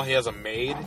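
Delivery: usual speaking voice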